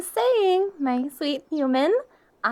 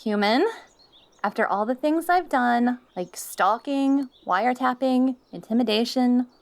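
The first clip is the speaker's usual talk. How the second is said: ordinary talk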